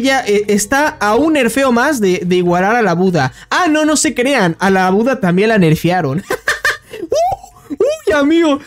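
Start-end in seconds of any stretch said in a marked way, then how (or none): 6.3-8.6 high burst of laughter; laughing. Real, not acted. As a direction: laugh it out, pitch way up. Laughs at Buddha nerf.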